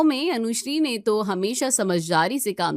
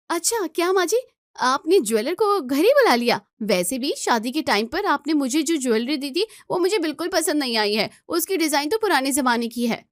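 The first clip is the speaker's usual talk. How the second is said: higher, complaining daughter-in-law; indignant bride; 5 semitones higher than usual. Acted. Elder bride protests to the aunt, higher and indignant. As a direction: protest that the elder gets it first.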